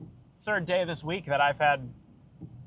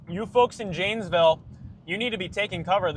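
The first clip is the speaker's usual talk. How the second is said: urgent warning; 5 semitones higher, 6 dB louder than usual. Real, not acted.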